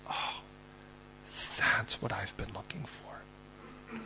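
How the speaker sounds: very slow, breathy near-whisper; hushed wonder. Acted. Preacher voicing God stopped in his tracks, hushed wonder. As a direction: draw it out softly, like a long-awaited find.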